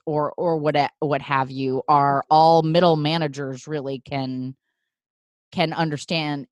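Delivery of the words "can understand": ordinary delivery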